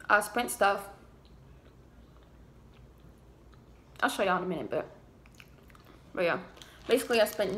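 speaking between bites; talking while chewing fries. Real, not acted. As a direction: mouth partly full of food. Seen 1: Fry brought to mouth at 0.4, chewing afterwards, mouth clears by 3.3.

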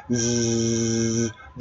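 soft, very slow held buzz; a quiet drawn-out voiced zzz; long buzzing zzz. Shown as a demonstration. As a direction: hum a long Z like a sleeping snore. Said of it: "ズーって発音します".

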